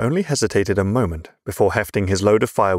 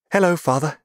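put-on much higher girl's greeting; sweet daughter; 9 semitones higher than usual. A narrator's reading. Favourite child greeting her father, sweetly.

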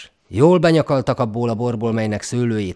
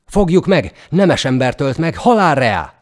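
hangos, magas, uszító kiabálás; dühös kiáltozás; 5 semitones higher, 7 dB louder than usual. A narrator's reading. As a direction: hajszold kiabálva a menekülőt. Katonák üldözésre uszítanak. (felbőszült, hangos kiáltozás)

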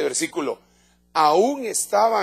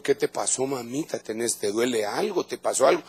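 tender comfort; 5 semitones lower, 5 dB quieter than usual. Retold or imitated. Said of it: "Entonces le dijo".

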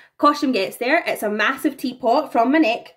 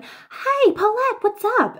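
much higher bright call, as if over a speaker; calling over the tannoy; 12 semitones higher, slower than usual. Retold or imitated. Elle calling Paulette over the tannoy, high and chirpy. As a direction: sing out a cheery greeting from offstage. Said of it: "I can hear over the tannoy".

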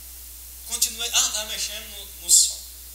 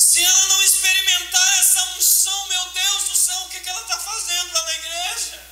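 high loud wail of grief; preaching at full force with a sung wail; anguished cry; 7 semitones higher, 3 dB louder than usual. Real, not acted. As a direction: cry out over their empty faith.

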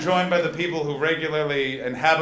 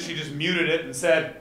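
ordinary delivery

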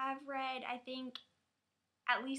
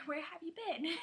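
laughing; 5 semitones higher than usual. Real, not acted. Laughs at uninformed viewers.

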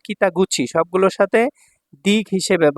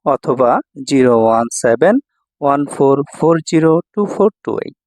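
louder, punchy promo announcement voice; ad read; 4 semitones lower, 4 dB louder than usual. Acted. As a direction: sell the school like a radio ad. Promo read before the lesson.

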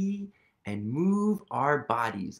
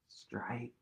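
whisper; 10 dB quieter than usual. Real, not acted. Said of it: "answer whispered".

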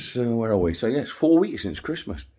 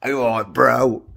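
imitating bro talk; much slower than usual. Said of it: "as you keep calling me".

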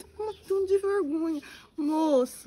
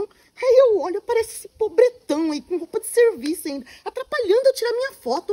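loud, higher contempt; scornful outburst; 5 semitones higher, 7 dB louder than usual. Acted. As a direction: sneer at him out loud. Girl mocks the poor worker.

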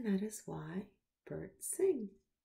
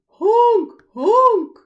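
loud, high honking; goose honk; 13 semitones higher, 20 dB louder than usual. Acted. Narrator honking like geese gathering, loud and high. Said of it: "some geese saying".

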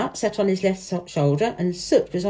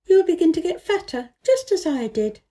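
a higher, lightly voiced young girl; girl's voice; 9 semitones higher than usual. A narrator's reading. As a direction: read Mary as an eager little girl, pitched up. Mary voiced in a story.

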